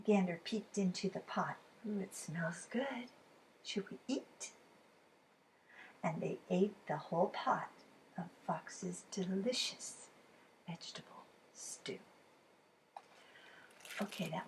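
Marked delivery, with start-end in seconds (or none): 1.9-4.5 soft, airy whisper; hushed whisper. Acted. Gander peeks into the pot, hushed whisper. 8.2-12.0 fading whisper. A narrator's reading. Narrator closing the tale for children, fading whisper. Story ending softly.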